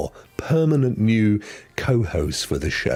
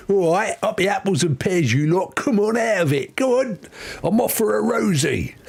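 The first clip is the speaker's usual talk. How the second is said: higher, cheeky Cockney voice for Al; mock Cockney accent; 9 semitones higher than usual. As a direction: put on an old London Cockney accent. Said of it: "with the old Cockney accent".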